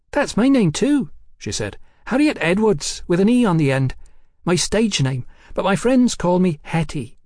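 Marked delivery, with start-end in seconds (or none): 0.1-1.1 put-on much higher, lilting voice for a forward London girl; high-voiced cheeky London girl. A narrator's reading. Hetty in story dialogue. 2.1-7.2 put-on much higher, lilting voice for a forward London girl; high-voiced cheeky London girl. A narrator's reading. Hetty introduces herself.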